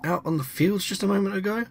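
a high startled cry on realising where they are; alarmed Freya. Acted. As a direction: pitch up in sudden alarm. Freya voiced, suddenly lost in woods.

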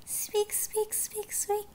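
high-pitched little mouse squeak. Acted. Imitating mice in the story.

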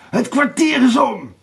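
high, loud warning; shouting. A narrator's reading. Blauwbaard calls time on Erika, shouting. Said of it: "riep Blauwbaard".

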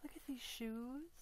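normal talking voice

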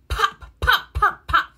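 short, loud pops; cork pops. A narrator's reading. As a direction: pop sharply like a cork. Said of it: "He can sound like a cork".

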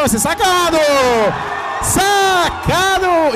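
excited shout. Real, not acted. Live sack on play-by-play.

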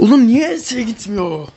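high, drawn-out frustrated whine; exasperated complaint. Real, not acted. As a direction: stretch out the question in sheer frustration. Same failure again, frustrated.

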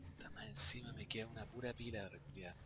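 unvoiced whispered grumble; whispering. Real, not acted. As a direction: whisper it under your breath. Muttered complaint.

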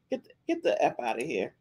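muttered dismissal. Real, not acted. Disgusted dismissal.

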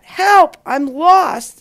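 high, loud plea; frightened cry for help. Acted. Lost boy begs an unseen voice, frightened high cry. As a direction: cry out for help, then ease off.

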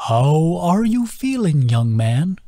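put-on slow, high, lilting old creature; slow sing-song voice of a gentle old turtle. A narrator's reading. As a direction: draw each word out in a musical sway. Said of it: "His sing-song voice".

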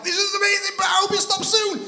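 high, loud thrill on the ride; thrilled shout. Retold or imitated. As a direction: yell it mid-drop, half delighted, half scared.

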